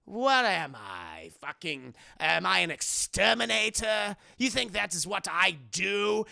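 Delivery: affronted cartoon villain; Joker. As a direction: draw it out with theatrical indignation. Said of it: "And Joker's kinda like".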